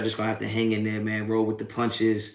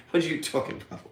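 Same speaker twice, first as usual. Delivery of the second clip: ordinary talk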